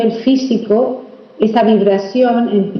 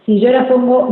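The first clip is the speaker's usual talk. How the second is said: ordinary delivery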